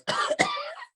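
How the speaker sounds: Rona cough. Shown as a demonstration. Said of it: "And the Rona cough is more like".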